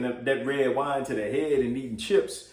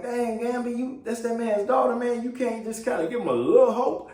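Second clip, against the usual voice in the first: much higher, disbelieving scold; exasperated thought; 10 semitones higher than usual. Retold or imitated. Viewer scolding Gamby for being negative, high and exasperated. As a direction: scold him in your head. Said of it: "I'm thinking like".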